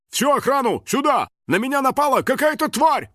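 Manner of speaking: high urgent shout for backup; alarmed call. Acted. Guard radioing for backup, alarmed.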